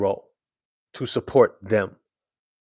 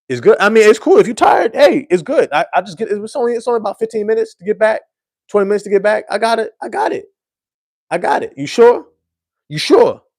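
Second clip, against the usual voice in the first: much higher, loud, fast protests that it's all good; insistent tipsy driver; 10 semitones higher, 5 dB louder than usual. Retold or imitated. Sleepy driver insisting he's fine, high and loud. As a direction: brush it off, squeaky and defensive.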